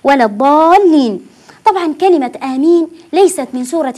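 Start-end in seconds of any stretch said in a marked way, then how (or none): 0.0-1.3 ولا الضالين with the long vowel held six counts; six-count madd. Shown as a demonstration. Teacher models the long stretched ولا الضالين as said in prayer. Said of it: "بهذه الطريقة".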